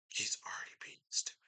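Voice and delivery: hushed, fast whispered repeat. Acted. Repeating the rival's brag to himself in a whisper. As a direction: breathe the line out as a quick secret.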